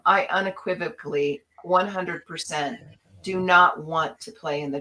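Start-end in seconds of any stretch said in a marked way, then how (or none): none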